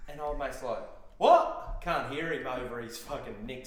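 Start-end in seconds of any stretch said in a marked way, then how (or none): 1.2-1.8 high, loud startled yelp; startled what. Retold or imitated. Young driver over loud subs, a startled loud what. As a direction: blurt it like you can't hear a thing.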